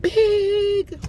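playful demand to the store; mock plea. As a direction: beg the store, with a squeaky big at the end. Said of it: "I said".

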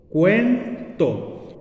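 "cuento" in two beats, "cuen", pause, "to"; correct syllable split. Shown as a demonstration. Teacher shows how the diphthong separates, "cuento" as cuen-to. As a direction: keep "cuen" as one syllable, then "to". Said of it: "se separaría como".

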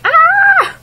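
high loud scream; child's scream. Retold or imitated. Imitating a child fleeing, a high scream. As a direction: shriek and run. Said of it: "He's like".

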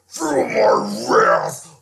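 slow, loud monster threat; menacing Hulk bellow. Acted. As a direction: drag out each word, menacing.

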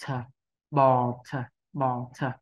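"bò thờ" split into two syllables with the ờ; learner's two-syllable "bò thờ"; slow beginner version. Shown as a demonstration. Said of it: "thì cứ đọc là bò".

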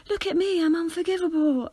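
high, silly taunting voice; mock them with an overdone high pitch. Shown as a demonstration. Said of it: "don't make your voice high pitched".

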